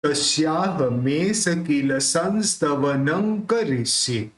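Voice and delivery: संस्तवनं said with न sounds, slowly; slow model recitation. Shown as a demonstration. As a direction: model the line for students. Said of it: "तो क्या निकलेगा?".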